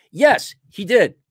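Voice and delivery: normal talking voice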